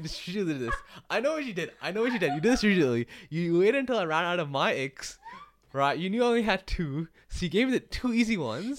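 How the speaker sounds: high-pitched, mock-outraged protest; playful accusation. Real, not acted. Calling out the co-host's sneaky strategy, playfully accusing. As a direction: call out their scheme, pitched right up.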